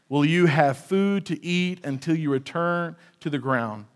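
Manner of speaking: slow, weighty Bible reading; measured pulpit reading of scripture; solemn scripture reading. Acted. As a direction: read the curse slowly, letting each line land. Reads Genesis curse aloud.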